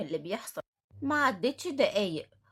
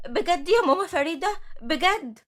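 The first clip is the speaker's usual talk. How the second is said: surprised delight; 6 semitones higher, 7 dB louder than usual. Acted. Farah thrilled by job offer.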